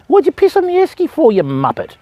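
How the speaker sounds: high teasing scold of a naughty dog; mock scold to a dog. Real, not acted. Fisherman teasing the dog that peed on his esky, high mock scold. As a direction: tease the dog for peeing, pitch up.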